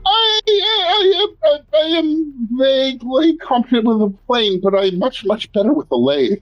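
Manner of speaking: high, faltering character voice; timid, high-pitched hedging; hesitant Aloysius. Acted. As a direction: stammer it out in a high, wobbly voice. Plays Aloysius.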